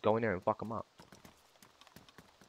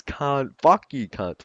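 normal talking voice

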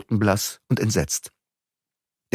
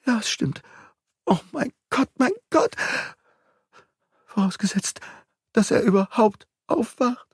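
panicking nursemaid; 13 semitones higher than usual. A narrator's reading.

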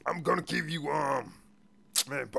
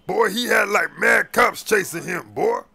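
raised, drawn-out exclamation; loud incredulous protest; 3 semitones higher, 5 dB louder, slower than usual. Acted. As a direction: exclaim it slowly and loudly in disbelief. Officer argues he deserved jail.

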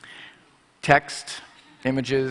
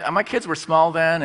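usual speaking voice

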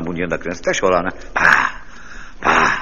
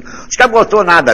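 scoffing retort; 10 semitones higher, 4 dB louder than usual. Acted. Friend scoffing loudly.

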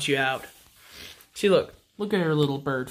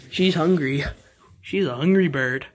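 ordinary delivery

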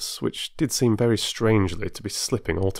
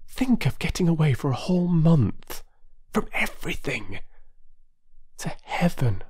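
ordinary delivery

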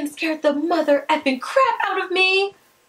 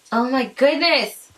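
ordinary talk, nothing marked

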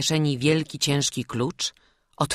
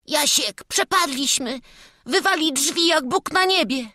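high, despairing cry; frightened, high-pitched lad; panicked boy; 13 semitones higher, 5 dB louder than usual. A narrator's reading. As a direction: cry out that all is lost. Boy despairs as door gives.

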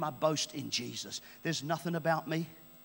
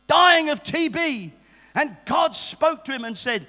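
high, loud preacher's storytelling; fervent testimony preaching; 7 semitones higher, 8 dB louder than usual. Real, not acted. Preacher telling the congregation of John Babu's testimony, fervent and loud.

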